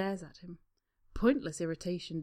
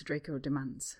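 usual speaking voice

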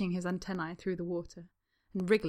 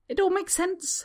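much higher, flustered blurt; higher than usual; 12 semitones higher, 6 dB louder than usual. A narrator's reading. Harry blurting support for Draco in a higher voice. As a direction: squeak it out nervously. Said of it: "voice pitched rather higher than usual".

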